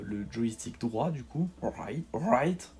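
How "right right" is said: "right" said the English way, with a French speaker's poor English accent; clumsy English "right, right" from a French speaker; bad English accent. Real, not acted. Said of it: "Désolé pour mon accent anglais pourri". ("right" avec un mauvais accent anglais)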